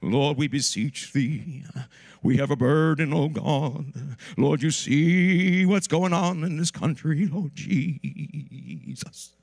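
slow, loud, quavering holy-voice prayer; churchy vibrato prayer parody; mock preacher prayer. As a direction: ham up a pompous preacher praying. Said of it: "but they put vibrato".

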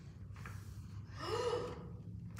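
soft, drawn-in gasp of shock; dramatic gasp. A narrator's reading. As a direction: gasp softly in suspense. Suspense at the pond.

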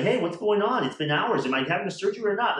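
high, loud and fast, fed up; impatient demand. Retold or imitated. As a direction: fire the questions off, exasperated. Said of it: "And I was like".

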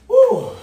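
loud high whoop; excited whoop. Real, not acted. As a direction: let out a big loud woo. Whoop ending round one.

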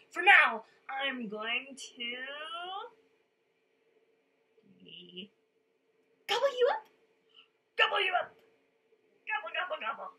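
menacing troll. A narrator's reading. Troll threatening to eat the goat, loud and hungry. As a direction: build to a big gobbling shout.

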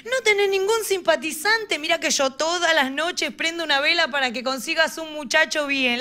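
put-on nosy grandmother asking about boyfriends, high-pitched; grandma's voice. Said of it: "aparece mi abuela con la pregunta". (imitando a la abuela, voz aguda de viejita)

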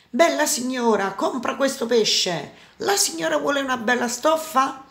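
lilting, high vendor's offer; market vendor. A narrator's reading. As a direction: call out your wares to a passing lady. Market vendors calling out wares.

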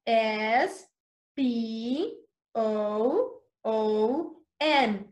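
letters spaced out slowly; slow spelling. Shown as a demonstration. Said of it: "Let's spell a spoon".

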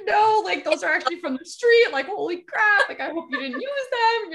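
high, loud re-enacted panic; frantic warning. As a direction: act out mortified alarm, voice pitched right up. Frantic warning to teacher retold.

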